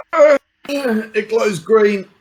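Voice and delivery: high, slow words stretched through a yawn. Acted. Christopher yawns while reporting the green glow. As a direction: drag it out mid-yawn, bored. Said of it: "Christopher yawns and goes".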